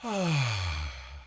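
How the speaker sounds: big open sigh on ah; dramatic sigh. Shown as a demonstration. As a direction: let out a nice big sigh. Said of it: "and sigh".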